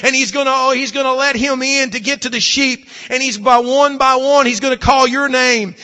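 fervent pulpit delivery; preaching. Acted. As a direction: preach it out to the congregation. Preacher at full force.

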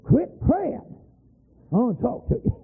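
high, sharp orders; retold sharp command. Preacher ordering screaming woman, high sharp commands. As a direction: stop her praying short. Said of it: "I got out of the side and said".

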